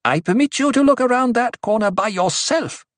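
high, forceful retort; snarled. A narrator's reading. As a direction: snarl it down at the heckler. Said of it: "Aliyah snarled".

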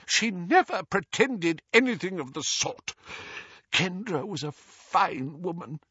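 high, miserable protest; pained, pleading defence. A narrator's reading. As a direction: protest wretchedly, as if near a whisper. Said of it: "whispered Doge miserably".

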